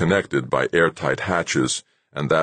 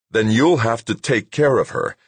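pushy, combative, louder and higher; belligerent; 8 semitones higher, 4 dB louder than usual. A narrator's reading. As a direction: lean in, spoiling for a fight. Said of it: "with more than a hint of belligerence in his voice".

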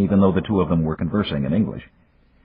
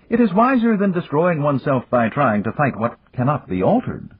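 higher, measured proverb-giving voice; Yamagata; 10 semitones higher than usual. A narrator's reading. Yamagata offering Dan a proverb, higher and measured. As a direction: pass on ancient wisdom gently.

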